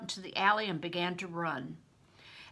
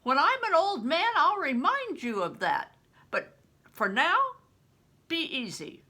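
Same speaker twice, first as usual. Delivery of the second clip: higher and louder, amused big-man voice; grinning Bear; 9 semitones higher, 6 dB louder than usual. Acted. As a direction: grin through it, fond and playful. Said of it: "Bear looked around at me and grinned".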